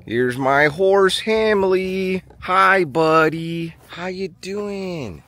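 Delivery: high, slow fond greeting; sweet hello to the horse; slow high pet greeting; greeting horse fondly. Real, not acted.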